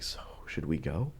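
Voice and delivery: quiet, cautious suggestion; softly floated question; hushed and tentative. Retold or imitated. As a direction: murmur it carefully, low volume. Hesitant huddle in parked car.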